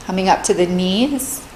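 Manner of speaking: calm, measured meditation voice with long pauses; guided meditation. Real, not acted. Meditation teacher leading listeners through a body scan, calm and spacious.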